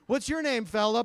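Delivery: high, loud kindly adult; friendly grown-up. Retold or imitated. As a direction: ask the kid his name, high and loud. Asking the kid his name.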